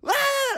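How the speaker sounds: high sharp yelp; mock high yelp; retold yell. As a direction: imitate the drunk fan's yelp. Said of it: "Fat bitch ... goes".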